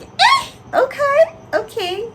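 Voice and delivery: high, slow "Eh, okay"; surprised relief. Retold or imitated. Student reacting to examiner's yes, high surprised relief. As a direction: squeal out your relief.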